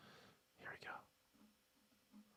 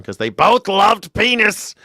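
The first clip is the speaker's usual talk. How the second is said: ordinary talk, nothing marked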